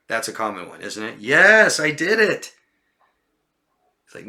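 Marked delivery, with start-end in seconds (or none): 1.2-2.5 high, loud protesting yes; insistent false claim. Shown as a demonstration. Teacher acting a liar's claim, insistent. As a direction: overdo the assurance, voice swooping up.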